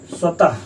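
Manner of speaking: स्वतः ending on an audible breathy ह; final ha demo. Shown as a demonstration. Teacher shows the visarga in स्वतः, breathy final ha. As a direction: finish स्वतः with a puff of हा. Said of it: "देखिए स्वतः last में हा आया".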